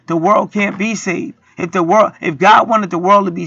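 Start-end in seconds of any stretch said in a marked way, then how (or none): none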